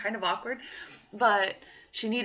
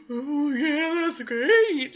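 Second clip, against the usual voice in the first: loud, high, gushing reply; imitated grateful acceptance; 6 semitones higher, 7 dB louder, much slower than usual. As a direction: imitate a woman eagerly accepting a hug. Imitates woman gratefully accepting a hug.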